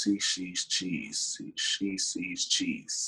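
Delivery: slow, deliberate and quiet; slow it down and place each word; slow careful. Shown as a demonstration. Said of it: "slowed down to get it right".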